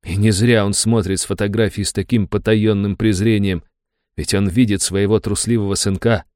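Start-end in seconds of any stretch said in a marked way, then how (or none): none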